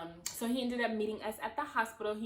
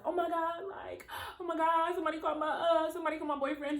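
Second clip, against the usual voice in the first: high, crying panic; frantic plea for help, imitated; mock panic; 9 semitones higher than usual. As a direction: herself in labor panicking, high and teary. Panicking, retold.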